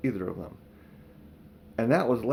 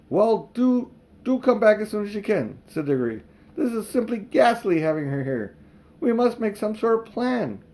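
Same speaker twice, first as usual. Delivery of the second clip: put-on higher, pleading boy; anxious boy; 8 semitones higher, 4 dB louder than usual. A narrator's reading. Diggory begs Polly to return, higher and anxious. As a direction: beg her to come back.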